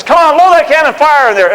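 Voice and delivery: loud, high order to fire; shouted cannon command. Retold or imitated. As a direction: bark the order over gunfire. Washington ordering cannon loaded.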